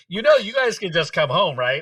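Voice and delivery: high voice through laughter; amused, high-pitched mock parental offer; laughing reassurance. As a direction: offer it while still cracking up. Laughing parent's offer after hearing quote.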